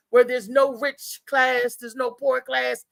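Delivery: ordinary delivery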